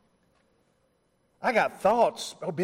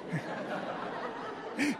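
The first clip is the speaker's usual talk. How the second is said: breathy laugh; 6 semitones higher, 11 dB quieter than usual. Real, not acted. Laughing at own retold story.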